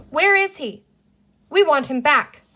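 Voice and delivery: high, loud stern demand. A narrator's reading. Sister demands the creature return their baby brother, loud and stern. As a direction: press him hard for an answer.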